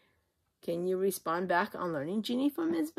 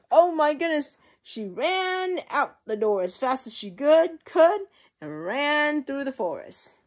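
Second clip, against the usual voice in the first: excited storytelling; 9 semitones higher, 8 dB louder than usual. A narrator's reading. Goldilocks flees the bears.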